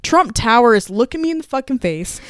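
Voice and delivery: usual speaking voice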